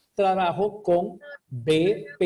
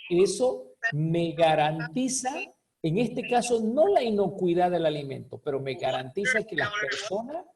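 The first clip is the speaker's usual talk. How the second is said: usual speaking voice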